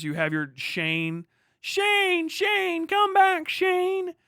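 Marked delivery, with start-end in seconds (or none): 1.6-4.1 high, loud plaintive call after someone leaving; mock boy's cry far across the hills; child calling out. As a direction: call the gunfighter back as a desperate kid. Imitating boy calling in film.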